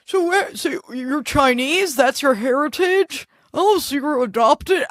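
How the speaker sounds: nosy relative digging at her heritage. Retold or imitated. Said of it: "Mike's racist family being like".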